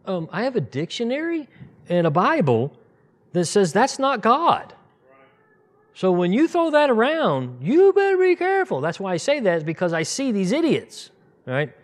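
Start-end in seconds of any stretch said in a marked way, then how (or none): 0.3-8.8 high, loud, building warning; rising indignant warning. Retold or imitated. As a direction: climb to a sharp warning peak. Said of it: "I'm sitting there going".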